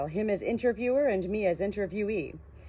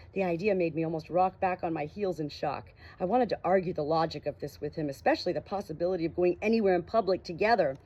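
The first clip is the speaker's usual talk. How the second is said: plain reading voice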